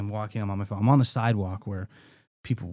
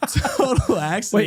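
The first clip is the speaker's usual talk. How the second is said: laughing; 9 semitones higher, slower than usual. Real, not acted. Laughing while retelling kick.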